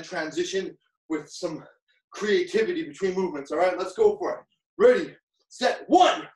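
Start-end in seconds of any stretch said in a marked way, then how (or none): 4.8-6.3 shouted start. Real, not acted. Starting the drill.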